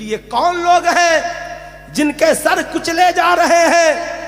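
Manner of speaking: high, loud quoted question, drawn out at the end; declaimed question. Retold or imitated. Preacher voicing the Prophet's question to Gabriel, high and proclaimed.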